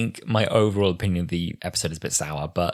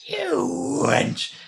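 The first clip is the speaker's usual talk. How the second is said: very high hammed-up squeal; shrieked mock insult. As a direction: echo the insult in a theatrical high voice. Echoes the acted insult.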